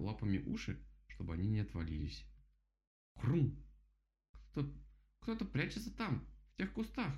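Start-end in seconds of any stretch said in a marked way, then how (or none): 3.2-3.6 громкий отрывистый «хрум»; изобрази хруст громко и резко; зловещий хруст. A narrator's reading. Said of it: "громкий и зловещий хрум". 4.5-7.1 высокий голос, запинка от страха; воскликни высоко, запнувшись от испуга; испуганное восклицание кролика. A narrator's reading. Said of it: "воскликнул кролик".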